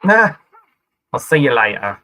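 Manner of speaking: loud mock London accent; a rough London accent said goodbye. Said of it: "doing my London accent".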